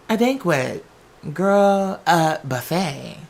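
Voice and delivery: ordinary talk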